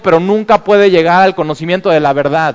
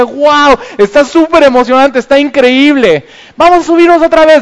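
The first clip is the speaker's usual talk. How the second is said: much higher and a bit louder, thrilled; excited; 9 semitones higher, 5 dB louder than usual. Retold or imitated. Father tells his kids after the first ride, thrilled. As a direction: gush to the kids like a thrilled dad. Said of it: "les dije".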